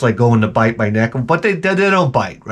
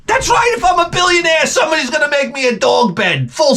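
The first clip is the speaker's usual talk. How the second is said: much higher, shouting the punchline; shouted rant. Real, not acted. Comic to the audience, shouting a billionaire fantasy. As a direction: yell it like a manic boast.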